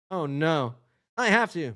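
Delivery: ordinary delivery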